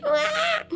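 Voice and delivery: high-pitched baby wail, imitated; mock baby cry. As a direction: imitate a crying toddler. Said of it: "crying like I was a baby".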